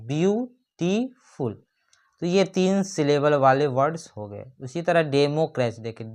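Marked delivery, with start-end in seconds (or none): 0.1-1.5 beautiful slow and higher, view - टी - फुल with gaps; three separate beats on beautiful; split into syllables. Shown as a demonstration. Teacher counting syllables for students, beautiful split into three sounds. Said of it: "इसमें तीन sound उत्पन्न होता है".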